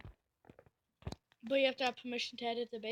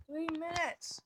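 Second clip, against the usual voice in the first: ordinary delivery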